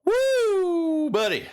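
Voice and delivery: squealed high woo buddy; excited yelp, high and loud. Real, not acted. Reacting to death.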